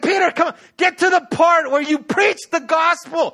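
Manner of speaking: loud, high, urgent urging; acted exasperated hurry-up; impatient shouted plea. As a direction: yell like a tag partner desperate to get in. Spirit begs Peter like wrestler.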